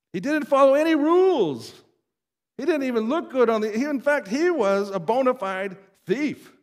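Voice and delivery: high, loud preacher's burst; rising pulpit fervour, pressing the point home; impassioned outburst. Real, not acted.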